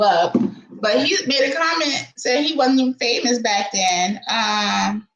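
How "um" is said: ordinary delivery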